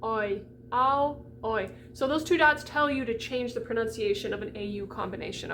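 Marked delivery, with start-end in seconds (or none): 0.0-1.7 ow then rounded oi; alternating ow and oi; ow versus oi. Shown as a demonstration. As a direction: contrast ow and oi clearly. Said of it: "So we get ow".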